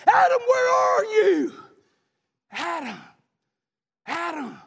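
very high, loud, slow shout; long searching call of God; God calling Adam. Acted. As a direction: stretch it out like a cry across a field. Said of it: "He's saying".

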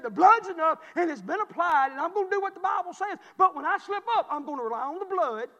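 very loud, high, shouted preaching; fired-up pulpit preaching at full pitch; full-force preaching. Acted. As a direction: hammer the point home to the church.

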